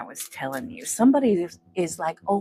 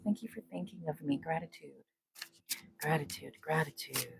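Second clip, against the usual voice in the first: soft murmur; 2 semitones lower, 11 dB quieter than usual. Real, not acted. Soft thanks to viewers.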